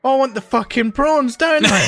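mock exasperated demand. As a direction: throw it back at the waiter in mock outrage. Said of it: "I'm like".

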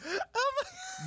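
high squeal of laughter, stretched out; laughing shriek. Real, not acted. Friend's story cracks him up.

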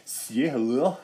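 slow, drawn-out mock gangster line; Scarface Californians mashup. Dad mimicking son's Scarface voice crossed with the Californians. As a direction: stretch it lazily, half sketch and half mobster. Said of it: "you sounded like Scarface in the Californians".

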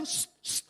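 soft, sharp shush; urgent shushing. Retold or imitated. Preacher shushing the shouting friend, urgent hush. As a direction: hush someone who is too noisy.

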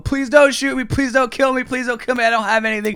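high, frantic pleading; panicked begging. Retold or imitated. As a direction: beg for your life, retold at full pitch. Acting out begging police for life.

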